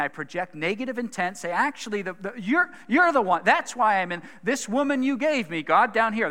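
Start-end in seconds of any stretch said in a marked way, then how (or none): 1.5-6.3 indignant blame. Retold or imitated. Voicing Adam blaming God.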